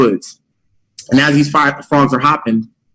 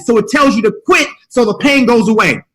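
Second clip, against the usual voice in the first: ordinary talk, nothing marked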